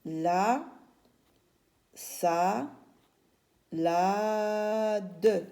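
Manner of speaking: "la, sa, la, de" in separate drawn-out syllables with long pauses; dictating by syllable. Shown as a demonstration. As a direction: hold each of "la, sa, la, de" while writing it. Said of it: "j'écris encore une fois ici".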